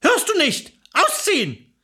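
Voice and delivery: high, insistent command; impatient ordering. Acted. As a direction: nag him to get moving. Wife impatiently repeats order to husband.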